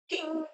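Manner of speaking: a very high, very soft sung "ding"; tiny high bell-like ding; imitated piano ding. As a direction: mimic one piano note ringing out. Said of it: "and you'll hear one note".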